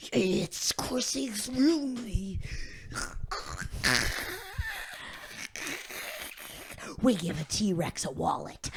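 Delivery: drugged slow voice. Pretend. Drink taking effect, slow low.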